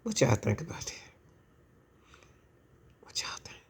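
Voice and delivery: whispered question to viewers; hushed aside to the audience. Real, not acted.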